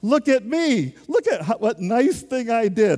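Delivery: high, loud, preening mock voice; hammed-up smug boasting; boastful self-praise. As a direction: ham up a proud show-off. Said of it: "I was now going".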